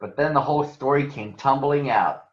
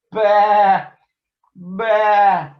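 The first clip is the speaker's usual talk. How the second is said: loud, high bleating baa; sheep bleat; 8 semitones higher, 7 dB louder than usual. Acted. Cub answering the gang with loud sheep bleats. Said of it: "bunch of sheep".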